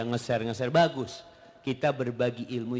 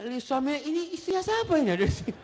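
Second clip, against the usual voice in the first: incredulous exclamation; 12 semitones higher, faster than usual. Real, not acted.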